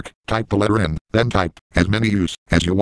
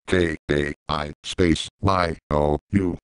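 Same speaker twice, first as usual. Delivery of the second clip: slow spelled-out letters; 5 semitones lower, 3 dB quieter, slower than usual. Shown as a demonstration. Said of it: "To have me say Caillou correctly".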